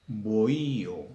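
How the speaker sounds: "Bohío" slowly, with a long stressed í; hiatus in bohío. Shown as a demonstration. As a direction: draw out bo-Í, then a separate o. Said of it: "vocal cerrada con tilde".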